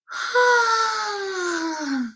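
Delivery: a high, very nasal sigh through the nose. Shown as a demonstration. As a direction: push all the air into the nose as you sigh. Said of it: "put as much air as possible into your nose and sigh".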